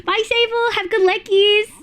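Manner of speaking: high, chirpy goodbye; cutesy farewell. Real, not acted. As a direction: send them off in a high little voice. Cutesy farewell to a leaving viewer.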